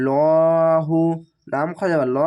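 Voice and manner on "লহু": লহু with a thick, heavy L sound; heavy full sound. Shown as a demonstration. Teacher shows the heavy sound of লহু. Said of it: "আল্লাহ লেখাটি মোটা করা হবে".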